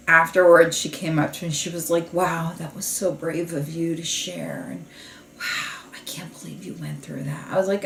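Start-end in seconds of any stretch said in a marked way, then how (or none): 2.1-7.5 softly retold show of sympathy, trailing into near-whisper; mock hushed sympathy. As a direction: imitate hushed, awed concern, fading toward a whisper. Retold gushing sympathy after group.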